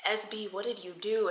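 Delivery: softly voiced grave question; hushed mother-like reproach. Friend acting like his mama, hushed reproach. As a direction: quietly demand an answer like his mama. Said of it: "now I'm on his mama shit".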